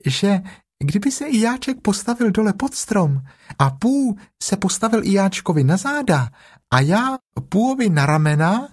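high little piglet voice; high-pitched, earnest small animal. Acted. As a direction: offer it seriously in a small voice. Earnest Piglet proposes a plan.

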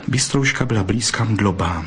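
plain reading voice

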